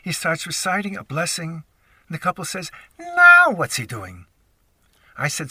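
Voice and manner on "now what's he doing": loud, drawn-out NOW, imitating annoyance; exasperated outburst. Fed-up airline passenger reacting to the praying rabbi, exasperated outburst. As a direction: blurt it out high and loud, mock-exasperated. Fed-up couple voiced in the anecdote.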